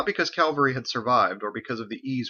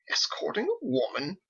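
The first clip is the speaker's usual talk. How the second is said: high, breathy whispered shock; scandalized gasp; 10 semitones higher, 3 dB quieter than usual. A narrator's reading. Thomas shocked at Michael, whispered scandalized gasp. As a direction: gasp it as if it were indecent. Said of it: "thought that was rather scandalous".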